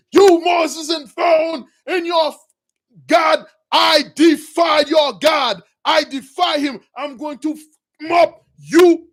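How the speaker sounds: high, loud furious shouting; raging king. Retold or imitated. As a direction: throw them out of the throne room in rage. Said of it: "with a rage and also with fear".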